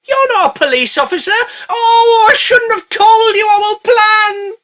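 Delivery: high, loud wailing shout, slowing at the end; panicked shout. Acted. Gang realising they blabbed to the robot, shouting in panic. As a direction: wail it out in mock despair.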